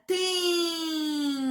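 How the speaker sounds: "tempo" with "tem" held very long; stretched syllable. Shown as a demonstration. Teacher showing another long syllable, "tempo" stretched out. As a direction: draw out "tem", then drop a short "po". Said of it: "uma sílaba também compridinha".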